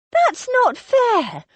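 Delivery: high, slow, heated objection; hot protest. Acted. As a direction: Rose bursts out, stung and indignant. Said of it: "Rose began hotly".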